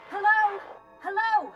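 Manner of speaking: high, loud hail into a dead comm; calling out. Acted. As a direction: shout to see if anyone hears.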